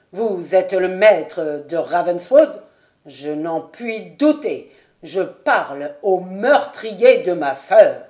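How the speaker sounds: slow, loud and halting accusation; broken voice. A narrator's reading. Colonel accusing Ravenswood of killing his sister, broken voice. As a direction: choke out each word at your sister's killer. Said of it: "d'une voix entrecoupée".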